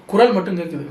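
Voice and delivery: ordinary talk, nothing marked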